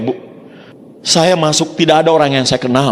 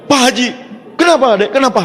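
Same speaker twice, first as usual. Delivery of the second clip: excited caller; 9 semitones higher than usual. Retold or imitated.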